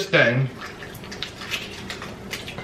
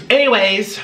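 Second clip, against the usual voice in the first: ordinary talk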